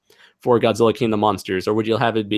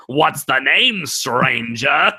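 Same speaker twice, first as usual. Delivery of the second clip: Cockney merchant; 3 semitones higher, 3 dB louder than usual. Acted. Host imitates Resident Evil merchant, put-on Cockney. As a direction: imitate a Cockney street seller. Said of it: "the Cockney accent who's like".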